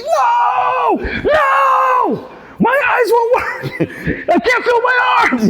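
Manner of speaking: put-on shrieking, high-pitched old man in a panic; hammed-up shrill old-man wail; panicked old man falsetto. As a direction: squeal it like a terrified geezer.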